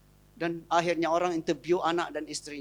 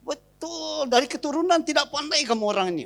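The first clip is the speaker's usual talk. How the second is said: rude wife; 6 semitones higher than usual. Retold or imitated. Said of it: "itu sudah salah tuh".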